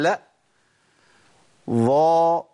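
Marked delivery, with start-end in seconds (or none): 1.7-2.4 ظا said with slight lip rounding. Shown as a demonstration. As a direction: say ظا with the lips slightly pursed. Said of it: "شوفوا كده".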